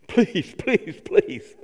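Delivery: lightly hammed-up pleading; mock begging. Pastor mock-pleading his wedding proposal, hammed-up. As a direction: beg in a playful mock voice. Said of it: "I pleaded, I begged".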